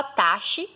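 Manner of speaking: "taxi" said as TA-shi; "taxi" hushed to TA-shi; wrong SH pronunciation. Shown as a demonstration. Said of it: "Você não fala taxi".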